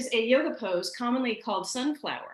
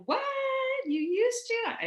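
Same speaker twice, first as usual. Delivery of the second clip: mock surprise. Imitating people reacting to her smoking past, high and shocked. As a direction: play their disbelief. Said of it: "people are like".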